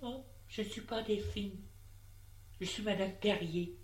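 a hint of a cool lady denying it; woman's voice. A narrator's reading. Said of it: "elle lui dit".

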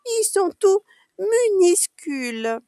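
put-on very high, slow childlike amazement; astonished exclamation. A narrator's reading. Child amazed at the tiny toilets, astonished. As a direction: stretch it out high in wonder. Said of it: "s'écriait Pauline".

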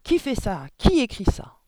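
high, loud frantic yell; panicked headmistress yelling at nobody; shouting. A narrator's reading. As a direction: scream it into the empty room. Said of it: "elle cria dans le vide".

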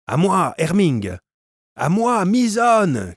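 high, slow, desperate shout; crying out for help. A narrator's reading. The second calling his allies mid-fight, desperate shout. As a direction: bellow the names across the fight. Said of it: "s'écria".

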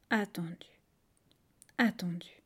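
ordinary delivery

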